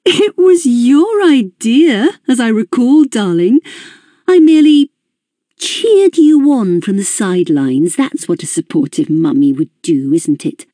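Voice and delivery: high, mocking, sing-song mother's voice; a poisonous mummy, patronising and amused; derisive mother. A narrator's reading. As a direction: sneer down at her daughter, all false sweetness. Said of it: "She laughed".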